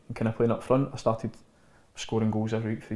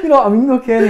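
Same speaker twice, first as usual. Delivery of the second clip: much higher and louder, half-laughing protest; mock pleading shout; 12 semitones higher, 14 dB louder, faster than usual. Cheeky youth pleading with coach Kenny, loud laughing protest. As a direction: yelp it out like a cheeky teenager.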